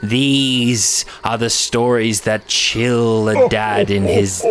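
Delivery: slow, dramatic ghost story narrator; horror host. Acted. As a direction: weave the tale like a campfire horror host.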